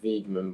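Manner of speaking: Weigman said with an ee, "Veegman"; pronunciation of Weigman. Shown as a demonstration. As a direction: say Weigman as "VEEG-mun". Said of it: "I pronounce it Weigman".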